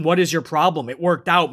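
usual speaking voice

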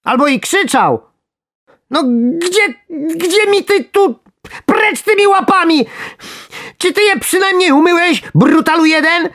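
very high, loud, squeaky protest; outraged worm. A narrator's reading. Worm telling angler to get his hands off, outraged shrieking.

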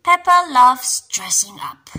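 ordinary delivery, nothing marked

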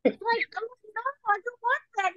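put-on very high childlike protest; little-girl refusal. Daughter turning down what mommy offers, high and childlike.

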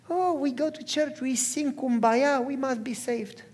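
high, airy mock complacency; mock churchgoer. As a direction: parody naive believers, softening toward the end.

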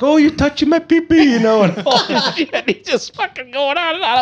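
squealing high, loud mock-offended voice; a buddy clowning for the cab driver, very high and silly; goofy mock voice. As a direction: ham it up like a goofy prankster in a cab. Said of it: "this goofy prick's acting".

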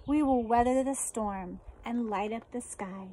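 ordinary talk, nothing marked

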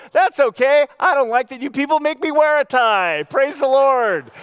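high, loud and cheery, slowing on praise the Lord; beaming, loud retort to the tie complaint; joyful comeback. Retold or imitated. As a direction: answer the critic with sunny delight. Pastor's joyful reply to tie critic.

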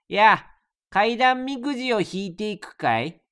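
a hint of a spooky game host; host character voice. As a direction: invite the player in as a sly host. Voicing the game's host character.

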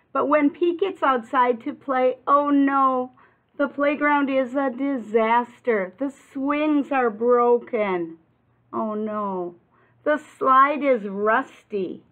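put-on lively read-aloud for little kids; storybook reading. A narrator's reading. Teacher reading a picture book to kids, animated and bright. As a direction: paint the disaster for the children.

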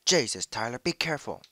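loud, high alarmed yell; shouting warning. Real, not acted. Teammate in danger, yelling warning.

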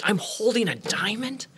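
high, wide-eyed disbelief; amazed. Acted. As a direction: act out a boy awestruck by what he's holding. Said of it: "and he's amazed by it".

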